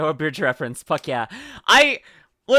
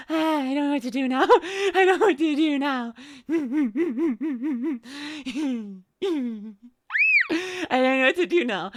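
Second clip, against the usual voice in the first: high, breathless laughing words; laughing giddily; 7 semitones higher than usual. Real, not acted. Streamer flustered after a yes, laughing helplessly. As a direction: giggle through it, overwhelmed. Giddy after date acceptance.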